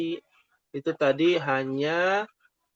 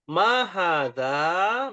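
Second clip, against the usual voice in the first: slow, drawn-out question; slow model question; much slower than usual. Shown as a demonstration. Teacher drills the Arabic question slowly. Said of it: "Berarti kalau ditanya".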